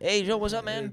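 high, horrendous accent impression; Alejandro. Shown as a demonstration. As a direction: show how he sounded in a hammed-up accent. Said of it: "That's what he sounded like".

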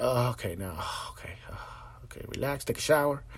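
hushed, near-whispered, sinking into stillness; lazy iguana calm. Pretend. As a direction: let it all go like a lizard in the sun. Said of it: "become an iguana for a second and go like".

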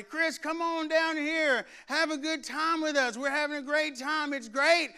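mock yelling friends at a pool party. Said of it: "they would yell at me".

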